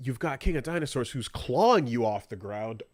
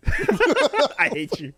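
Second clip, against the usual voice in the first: usual speaking voice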